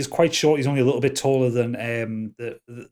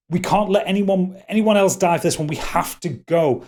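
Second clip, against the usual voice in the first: raised, pressing voice of an elf; higher, louder, desperate urging; urgent plea; 7 semitones higher, 4 dB louder than usual. Acted. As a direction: urge them to leave at once. Wood elf urges party to flee.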